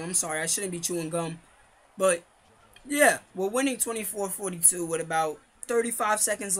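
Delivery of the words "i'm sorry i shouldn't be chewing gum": usual speaking voice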